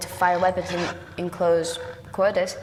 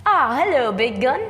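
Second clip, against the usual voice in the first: menacing sarcasm; 2 semitones higher, 6 dB louder than usual. Acted. Threatening villain with gun.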